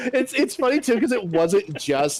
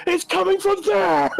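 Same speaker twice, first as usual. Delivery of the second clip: much higher, panicked call; mock frantic yell. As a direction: burst in shouting.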